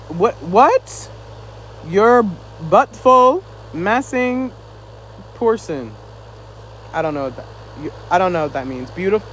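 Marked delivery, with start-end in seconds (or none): 1.9-5.9 slowly sounding out unfamiliar words; halting reading. Real, not acted. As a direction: stumble through reading a message aloud, word by word. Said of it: "I was trying to read it".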